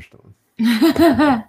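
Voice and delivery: laughing mock celebrity voice; Stallone joke voice. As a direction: ham up a Stallone line, laughing. Joking impression of Stallone.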